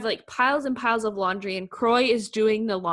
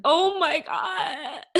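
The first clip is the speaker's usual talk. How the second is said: high, drawn-out oh-my-God; retold gasping reaction; 7 semitones higher, much slower than usual. Retelling own reaction to the spirit's message, overcome. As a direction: stretch it out, pitched up and overcome. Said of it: "And I was like".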